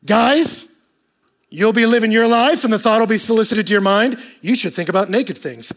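loud, high, driving sermon delivery; preaching at full force. Acted. Pastor pressing the congregation about loneliness, at full force. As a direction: preach it out, building.

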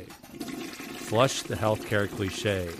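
very slow, drawn-out segment title; segment announcement. Pretend. Host introducing a recurring podcast segment, slow announcer delivery. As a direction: announce the segment title slowly, leaving space.

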